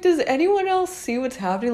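high-pitched disbelieving question; incredulous. Retold or imitated. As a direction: ask it like you can't believe nobody notices. Disbelief at class ignoring chaos.

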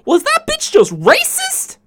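high, loud, slow call-out; loud, slow high-pitched shout; yelled out. Retold or imitated. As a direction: yell it for the whole store. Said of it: "I yelled out".

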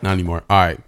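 talking through a bite; chewing while talking; mouth full. Real, not acted. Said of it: "You're chewing in the mic".

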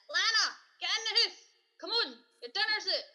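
loud, high call across the street; mum calling out. Retold or imitated. Mum shouting down the street for Lana, loud call. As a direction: yell for the kid to come home for dinner.

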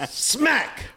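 high, loud shouted "Smack!" as a sound effect. Acted. Teller faking a slap on the old woman, shouted smack. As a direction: yell the slap noise.

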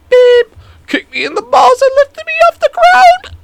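loud squealing falsetto, in pain; hammed-up, very high agonized wail; highest-pitched voice. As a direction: squeak it out as high as you can. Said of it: "most high-pitched voice I could say".

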